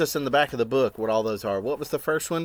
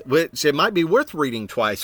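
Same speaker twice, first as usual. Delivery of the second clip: ordinary delivery, nothing marked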